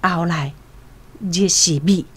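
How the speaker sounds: ordinary talk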